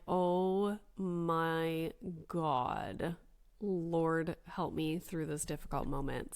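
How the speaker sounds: slow, hushed plea for patience; understated overwhelmed parent praying under the breath; mock weary prayer.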